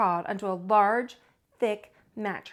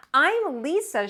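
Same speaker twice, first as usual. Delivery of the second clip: put-on higher, louder cheerful girl; little girl voice; 6 semitones higher, 5 dB louder, slower than usual. Lisa greeting the bear, cheerful. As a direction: announce yourself proudly. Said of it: "she said".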